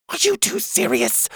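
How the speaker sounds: plain reading voice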